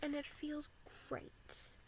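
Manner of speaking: soft whispered confession. Real, not acted. Speaker confiding to viewers, in a whisper. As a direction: whisper it like a secret.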